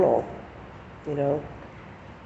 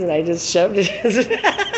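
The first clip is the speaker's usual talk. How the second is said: high, loud, laughing through the words; can't help laughing; a real laugh breaking in; 5 semitones higher, 4 dB louder than usual. Real, not acted. Laughing at the memory.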